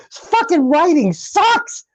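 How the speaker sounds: high, slow, disgusted complaint; exasperated rant. Real, not acted. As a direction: spit out the frustration. Ranting about the movie.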